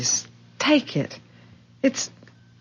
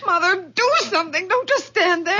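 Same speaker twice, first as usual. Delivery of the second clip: fast, high urgent plea; panicked plea; 8 semitones higher than usual. Acted. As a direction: push Mother to act, in a panic. Laurie fainted, daughter panics.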